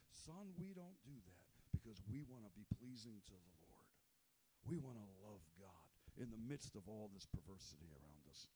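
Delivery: calm your son lovingly; father gently guiding his son; gentle father. Retold or imitated. Enoch teaching his son.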